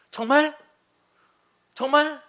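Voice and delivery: high, loud amazed double take; surprised disbelief. Retold or imitated. A father hearing his son is healed, high and amazed. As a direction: gasp it out as if you can't believe it. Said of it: "이렇게 얘기해야 되는데".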